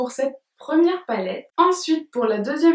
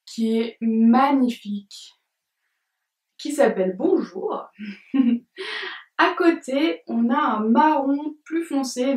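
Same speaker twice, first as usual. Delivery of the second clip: ordinary talk, nothing marked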